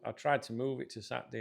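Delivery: normal talking voice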